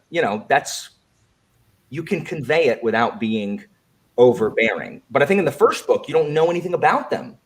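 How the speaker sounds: normal talking voice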